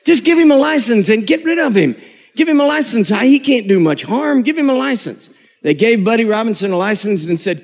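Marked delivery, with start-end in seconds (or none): 0.0-5.3 exasperated. Retold or imitated. Exasperated superintendent.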